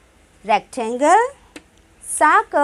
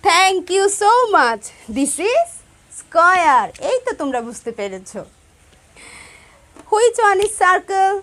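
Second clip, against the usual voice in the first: ordinary delivery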